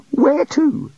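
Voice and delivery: high, startled question; panicky. A narrator's reading. The god fears the handkerchief, panicky. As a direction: ask in alarm.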